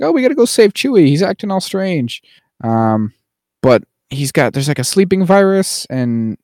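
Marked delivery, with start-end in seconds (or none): 0.0-2.2 high, fast, worried heroes; mock urgency. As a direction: squeal it high and quick, like cartoon heroes rushing off. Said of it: "go like".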